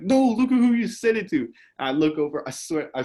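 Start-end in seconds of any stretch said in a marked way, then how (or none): 0.0-1.5 loud high scolding shout; classmates' shout. Retold or imitated. Classmates telling him to look, loud and high. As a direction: call him out, pointing at who he hurt. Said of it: "It's like".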